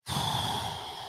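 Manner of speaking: long, soft breathy sigh; heavy sigh. Real, not acted. Host hearing of a friend's separation, long sympathetic sigh. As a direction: let out a long, quiet sigh.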